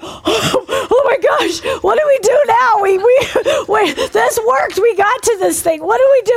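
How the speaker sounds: high, gasping, loud alarm; mock panic. Two first-time deliverance ministers face a demon, high panicked voice. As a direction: act out frantic, stunned helplessness.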